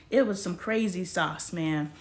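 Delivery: normal talking voice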